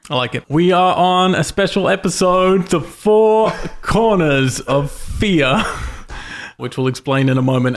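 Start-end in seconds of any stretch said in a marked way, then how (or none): 0.5-5.6 commanding fervent incantation. Acted. Announcing special episode title.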